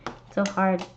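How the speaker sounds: ordinary delivery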